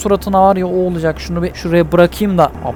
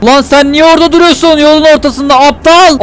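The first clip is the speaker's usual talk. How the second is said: higher-pitched heated scolding; angry yell; 6 semitones higher than usual. Acted. Driver yells at man standing in road, angry. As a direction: yell at the idiot in the way.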